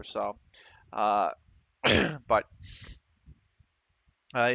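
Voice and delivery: ordinary talk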